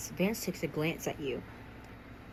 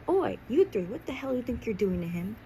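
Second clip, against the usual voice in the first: confronting call-out; 6 semitones higher than usual. A narrator's reading. Student confronting bullies picking on Finney, raised challenge. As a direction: call them out sharply from the first word.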